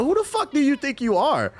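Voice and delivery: high, loud indignant rant; exasperated outburst. Real, not acted. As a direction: blow up at the nerve of people. Venting at line-cutters.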